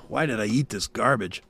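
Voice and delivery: ordinary talk, nothing marked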